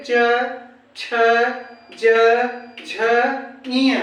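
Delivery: च, छ, ज, झ, इय one at a time, tongue to palate; isolated palatal sounds च, छ, ज, झ, इय for pupils to copy; palatal consonant demo. Shown as a demonstration. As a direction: sound out च, छ, ज, झ, इय with long gaps. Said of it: "बोलिए".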